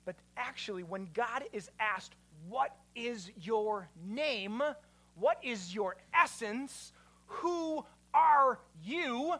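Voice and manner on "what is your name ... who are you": very slow, drawn-out questions; deliberate, building to a louder last question; solemn questioning. Acted. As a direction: stretch out every word as a weighty question. Acting out the question put to God.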